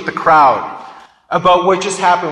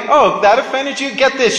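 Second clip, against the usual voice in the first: higher-pitched mock-surprised comeback; acted defiant retort; 6 semitones higher than usual. Preacher voicing Jesus answering the offended crowd, defiant retort. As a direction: toss it back, pitched up, as a challenge.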